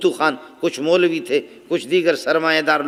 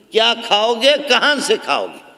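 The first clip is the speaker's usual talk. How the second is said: imitating doubters, pitched up on the first question; mock challenge; 5 semitones higher than usual. Doubters challenging a would-be cleric about his living, mock questioning. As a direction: throw their questions back, higher then falling. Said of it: "جو کہتے ہیں".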